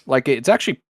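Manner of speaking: ordinary talk, nothing marked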